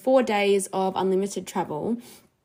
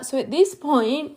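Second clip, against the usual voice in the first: ordinary talk, nothing marked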